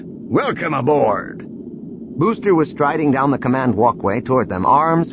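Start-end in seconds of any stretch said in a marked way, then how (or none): 0.0-1.5 slow, drawn-out hearty call; a host calling out a welcome to his guest; booming voice. A narrator's reading. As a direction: boom the name across the bridge. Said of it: "Booster's voice boomed".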